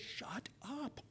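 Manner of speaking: sharp whisper to a noisy neighbour; whispered rebuke. Acted. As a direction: whisper it as a shushing. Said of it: "somebody behind you is making a noise".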